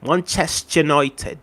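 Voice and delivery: Manchester United slowed down, United said like noitəd; Scouse accent. Shown as a demonstration. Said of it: "that's really how they say it".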